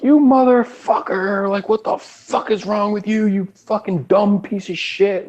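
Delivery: high, slow mock rage at the engineer. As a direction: screech it like an enraged rock star berating the engineer. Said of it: "he starts screaming at the engineer".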